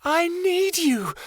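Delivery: very high, slow, furious pleading; jilted girl raging at Keanu; hurt rage. A narrator's reading. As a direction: rail at him through the bars. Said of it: "her hurt taken over by rage".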